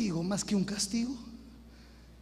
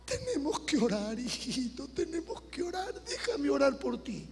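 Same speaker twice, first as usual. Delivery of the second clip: quiet tearful; 5 semitones higher, 6 dB quieter than usual. Retold or imitated. Mother quietly asking to pray.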